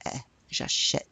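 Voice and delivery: the vowel "eh" alone, then "j'achète" with that open vowel; isolated eh sound. Shown as a demonstration. Teacher pointing out the vowel, "eh" then "j'achète". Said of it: "You hear the eh".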